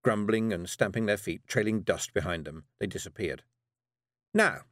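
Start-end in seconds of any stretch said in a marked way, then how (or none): 4.3-4.6 high, brisk witch voice; Miss Tick voice. Acted. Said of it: "said Miss Tick".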